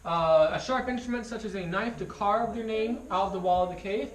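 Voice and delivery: loud, clear tour guide delivery; tour guide spiel. Acted. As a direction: project to the whole group like a guide.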